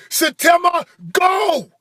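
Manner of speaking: high, loud coaching yell; trainer yelling. Retold or imitated. Young trainer yelling at him to row, loud and high. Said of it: "And he's like".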